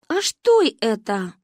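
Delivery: slow, wondering question; puzzled. A narrator's reading. The village girl wonders what a cartoon is. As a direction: ask in innocent surprise. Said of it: "удивилась девочка".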